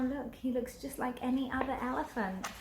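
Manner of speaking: usual speaking voice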